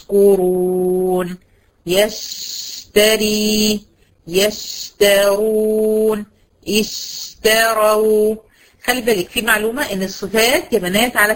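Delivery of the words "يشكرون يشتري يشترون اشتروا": long hissed "sh" held in يشكرون، يشتري، يشترون، اشتروا; flowing shin sound. Shown as a demonstration. Teacher showing rakhawa on the letter shin, long held "sh" in each word. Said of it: "جريان الصوت عند النطق".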